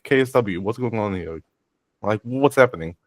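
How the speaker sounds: ordinary talk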